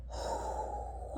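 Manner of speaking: soft, breath-only sigh; voiceless exhale of stress. Retold or imitated. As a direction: let out a quiet, weary breath.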